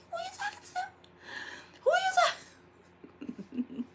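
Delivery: very high, hammed-up incredulous mom; mock confrontation. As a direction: squeak out the challenge in disbelief.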